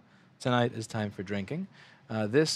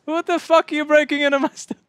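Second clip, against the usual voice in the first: 1 outraged shout; 9 dB louder than usual. Real, not acted. Yells at someone breaking in.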